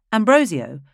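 ordinary delivery

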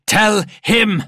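high, loud, fervent order; shouted command. A narrator's reading. Father orders daughter to tell the Despoiler, shouting. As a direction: bellow it to spur the child on.

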